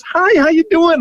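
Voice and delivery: high, friendly hello back. Retold or imitated. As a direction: play along like you know him. [name] playing along warmly.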